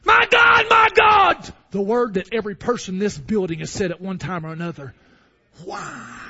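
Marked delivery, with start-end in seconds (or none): 0.0-1.5 high, loud shout of My God; anguished cry to God. Acted. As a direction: cry it out to heaven. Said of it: "I've said that".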